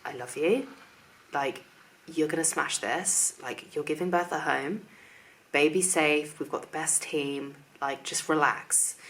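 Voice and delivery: soft, hushed soothing voice; imitating reassuring husband. Imitating her husband soothing her during labour, soft and hushed. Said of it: "then he was like".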